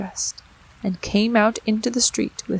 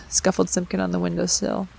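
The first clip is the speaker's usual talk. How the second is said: ordinary delivery, nothing marked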